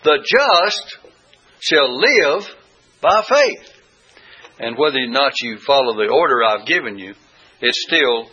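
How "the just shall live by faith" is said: The just, shall live, by faith, each phrase punched higher and louder, faith rising highest; emphasis on each word. Shown as a demonstration. Teacher shows the class that any of the three words can carry the stress. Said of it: "put the emphasis upon each one".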